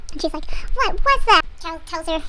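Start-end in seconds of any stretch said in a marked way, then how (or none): 0.7-1.4 high, louder startled question; mock bewilderment. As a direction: imitate a stunned heroine. Heroine bewildered again.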